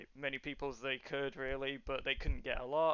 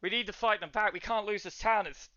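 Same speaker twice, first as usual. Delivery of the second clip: terrified guard; 3 semitones higher than usual. Acted. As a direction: plead urgently for reinforcements, pitch raised. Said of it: "terrified right now".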